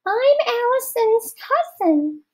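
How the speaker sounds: put-on high-pitched girl's voice. A narrator's reading. Rodney disguising himself as a girl for Missus Periwinkle, very high. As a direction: squeak it like a girl to fool her. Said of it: "putting on a high-pitched girl's voice".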